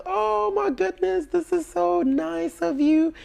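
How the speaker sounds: very high, slow, sugary thank-you; mock gushing. Thanking boyfriend for the gift, very high mock gushing. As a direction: gush in a squeaky high voice. Fake delight receiving a gift.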